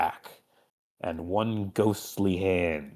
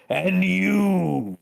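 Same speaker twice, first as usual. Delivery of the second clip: demon lord voice; slower than usual. Acted. Demon lord addresses Drifter.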